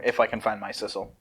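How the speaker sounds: usual speaking voice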